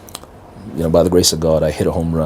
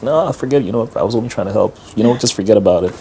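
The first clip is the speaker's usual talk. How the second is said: higher-pitched, hurried-off retreat; flustered backing down; 5 semitones higher than usual. Retold or imitated. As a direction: imitate someone hastily taking back an offer. Backing down after being screamed at.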